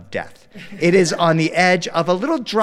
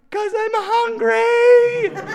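put-on much higher, slow kid whine through a laugh; whiny toddler; 3 dB louder, much slower than usual. Son explaining his tears to dad, slow whiny child voice. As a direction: drag it out like a hungry little boy.

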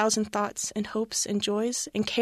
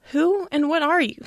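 higher, louder demand; 6 semitones higher, 5 dB louder than usual. A narrator's reading. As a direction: demand an answer from the ghost. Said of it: "Scrooge demanded".

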